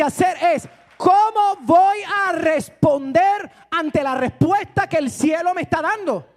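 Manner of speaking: loud, fervent preaching, slowing on key lines; full-force preaching. Acted. Pastor rousing the congregation, loud fervent preaching. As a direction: preach it out to the whole church.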